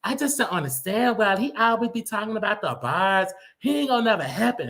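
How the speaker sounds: a lightly voiced high parody of a fan. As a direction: sneer it out as a fan defending their queen. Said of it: "they talk like this".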